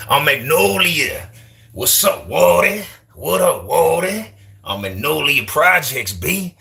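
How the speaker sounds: high-pitched, drawn-out Magnolia Projects accent; mock New Orleans accent. Shown as a demonstration. Streamer showing off regional accents, exaggerated New Orleans accent. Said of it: "New Orleans sounds their own way".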